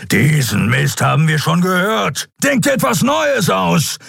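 hoch aufsteigendes Brüllen einer Bestie; brüll wie ein gereizter Löwe; löwenhaftes Brüllen. A narrator's reading. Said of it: "stieß ein löwenhaftes Brüllen aus". (löwenhaft gebrüllt)

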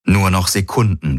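usual speaking voice

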